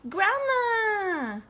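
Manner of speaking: high, loud, joyful call; excited. A narrator's reading. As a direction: spot her and call out with delight. Pete sees grandma from window.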